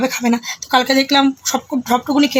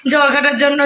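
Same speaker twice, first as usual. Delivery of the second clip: ordinary delivery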